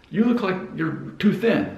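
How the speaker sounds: imitated viewer comment. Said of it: "You're like".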